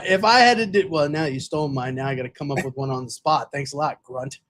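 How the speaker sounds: ordinary talk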